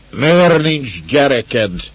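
loud, high, drawn-out accusing shout; quoted bellow. A narrator's reading. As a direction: roar it like the angry drunk husband. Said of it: "ordítja ilyenkor".

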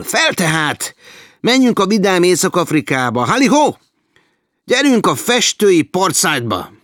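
loud, high-pitched call to set off; rally the gang with a whoop; exuberant, ringing cry of adventure; rousing rally. A narrator's reading. Rozsdás rallies the gang.

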